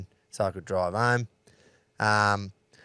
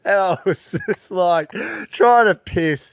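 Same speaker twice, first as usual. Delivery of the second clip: higher and louder, laughing through the words; laughing while talking; 7 semitones higher, 5 dB louder than usual. Real, not acted. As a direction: tell it while still cracking up. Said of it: "I'm shaking from laughing".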